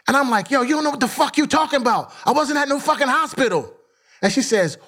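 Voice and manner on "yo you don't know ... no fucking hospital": retold protest, much higher in pitch; indignant retort. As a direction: fire back in a high, quick mock outburst. Acted quarrel, retorting to wife.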